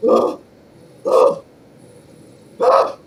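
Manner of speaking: loud yelps of pain, wildly jumping pitch; pained screams. Acted. Ogre with broken wrists screams in pain. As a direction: yell out as the wrists snap.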